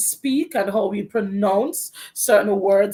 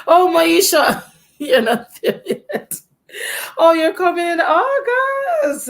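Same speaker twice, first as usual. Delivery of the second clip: higher and louder, laughing through it; delighted greeting; 8 semitones higher, 6 dB louder than usual. Real, not acted. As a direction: greet a friend you spotted, laughing happily. Greets viewer Maisha by name.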